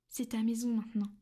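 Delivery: usual speaking voice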